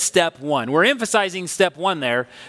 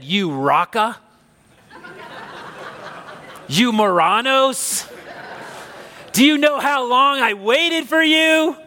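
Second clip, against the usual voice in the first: imagined angry scolding; 6 semitones higher, slower than usual. Retold or imitated. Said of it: "He could have said".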